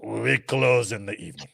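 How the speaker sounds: put-on light Russian accent, laughing through it; playful Russian accent, half laughing; mock Russian accent. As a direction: mimic a Russian official in a Russian accent. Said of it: "That's my Russian accent".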